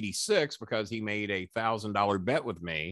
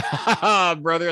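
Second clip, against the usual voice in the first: mocking laugh back; 7 semitones higher, 7 dB louder, slower than usual.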